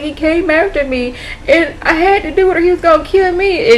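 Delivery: high, pleading self-defence; mock-distraught high voice; imitated distressed client. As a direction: act out a helpless, upset explanation. Retells shaken client explaining the killing.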